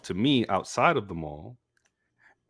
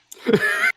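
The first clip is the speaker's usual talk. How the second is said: shrill disbelieving laugh; 8 dB louder than usual. Real, not acted. Disbelief after being fired.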